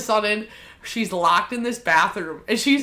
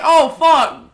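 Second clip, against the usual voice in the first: higher, louder mom reaction; imitated alarmed mom; 6 semitones higher, 5 dB louder than usual. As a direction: play mom startled, easing toward the end.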